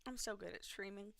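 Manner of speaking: quiet, deflated sarcasm; muttered self-mockery. Real, not acted. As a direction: mutter it at yourself. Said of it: "I'm sorry, guys".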